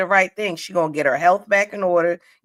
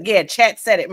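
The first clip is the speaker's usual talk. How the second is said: ordinary talk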